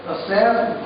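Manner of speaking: ordinary talk, nothing marked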